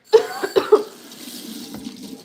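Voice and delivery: harsh, unvoiced coughing; coughing fit. Real, not acted. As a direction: cough hard from an itchy throat. Why my throat is itching.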